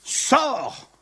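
loud, high single-word command. Acted. Jesus commanding the dead man out, loud shout. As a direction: bark it out at the tomb. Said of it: "lorsque Jésus dit".

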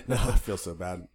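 laughing through the words. Real, not acted. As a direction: giggle it out. Laughs after kissing joke.

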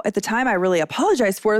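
ordinary delivery

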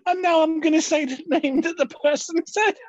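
high, squeaky with suppressed laughter; real giggles overtaking the words; helpless laughter. Real, not acted. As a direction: push the sentence out, then collapse laughing. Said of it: "I can't do this anymore".

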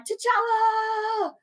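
high, drawn-out fan holler; calling out. Retold or imitated. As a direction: call the hero's name out long. Re-enacts cheering a hero's entrance.

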